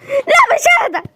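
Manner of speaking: high, slow frantic laugh-cry; frantic refusal. Acted. Panicked mother refusing to calm down, high and frantic.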